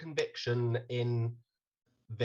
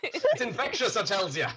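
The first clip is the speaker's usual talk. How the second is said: higher, laughing stage Irish; hammed-up Irish; 8 semitones higher, 3 dB louder than usual. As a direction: ham up the Irish brogue. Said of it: "Irish accent".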